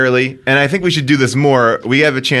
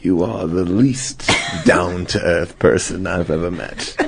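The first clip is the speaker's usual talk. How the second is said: Johnny Depp impression; 10 semitones lower, 5 dB quieter, slower than usual. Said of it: "He's like".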